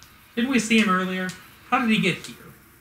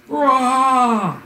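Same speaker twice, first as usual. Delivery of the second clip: higher, louder battle roar in an odd voice; rage roar; 8 semitones higher, 6 dB louder than usual. Acted. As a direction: roar as the rage takes over. Hero powering up in rage.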